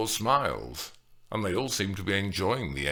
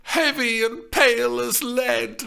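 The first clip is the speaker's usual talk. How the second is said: put-on old woman voice; 5 dB louder, slower than usual. A narrator's reading. End of a character's quoted line.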